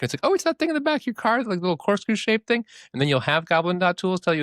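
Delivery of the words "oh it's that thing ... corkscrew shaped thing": quick, high-pitched explainer voice; helpful AI. Acted. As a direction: rattle it off high, playing an eager assistant. Voices the helpful AI's answer.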